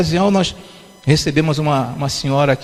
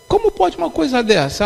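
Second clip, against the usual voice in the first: retold indignant challenge, pitched much higher; indignant disbelief; 8 semitones higher than usual. Preacher retelling how he confronted the woman, indignant disbelief.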